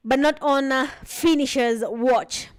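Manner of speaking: energetic live esports caster; play-by-play commentary. Acted. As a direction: call the match as it happens.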